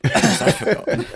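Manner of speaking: real laughter; laughing. Real, not acted. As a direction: crack up while saying it. Laughing at forgetting.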